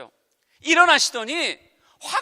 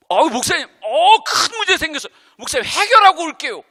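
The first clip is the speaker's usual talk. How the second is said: louder, higher panicked report; flustered member; 7 semitones higher, 11 dB louder than usual. Retold or imitated. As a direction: rush to the pastor in alarm. Church member panicking about problem. (당황해서 목사님께 달려온 성도)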